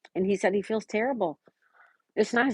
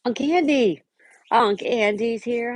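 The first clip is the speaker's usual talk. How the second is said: excited greeting; 5 semitones higher, 3 dB louder, slower than usual. Real, not acted. Greeting a viewer who joined.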